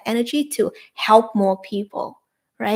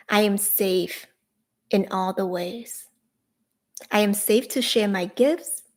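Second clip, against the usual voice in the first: guided affirmation. Shown as a demonstration. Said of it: "you can say this with me right now".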